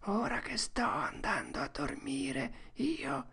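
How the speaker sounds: put-on slow, soft, high child's prayer; praying tone. A narrator's reading. Tom starts a prayer in hiding, praying tone. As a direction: recite it softly like a bedtime prayer. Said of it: "in tono di preghiera".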